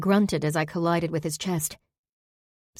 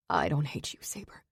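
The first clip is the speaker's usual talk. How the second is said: softer, hitching whisper; broken whisper; 2 semitones lower, 7 dB quieter than usual. Acted. Zack holding Saber, whispering through a breaking voice. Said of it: "His voice hitched, broke".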